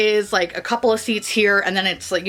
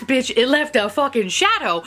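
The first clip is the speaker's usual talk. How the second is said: ordinary talk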